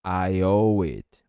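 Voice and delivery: "I owe it" drawn out slowly; slow it down for the learner to repeat; slow model phrase. Shown as a demonstration. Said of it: "Vai repetindo comigo".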